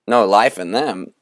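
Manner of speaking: ordinary delivery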